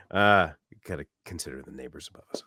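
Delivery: ordinary talk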